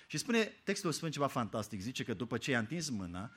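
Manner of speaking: ordinary talk, nothing marked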